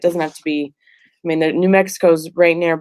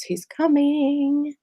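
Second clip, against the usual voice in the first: dramatic announcement; 9 semitones higher, much slower than usual. Pretend. Announcing the coach's arrival.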